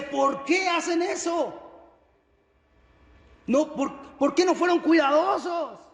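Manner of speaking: high-pitched, indignant outburst; angry king. Acted. King David rebukes the messenger over fallen men, angry. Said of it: "Y David se enoja".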